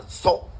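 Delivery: "zo" with a rounded o; Arabic letter name. Shown as a demonstration. Lecturer listing Arabic sibilants to students, rounded zo. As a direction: say "zo" set apart, rounded. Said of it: "cinco sonidos que se parecen a la S".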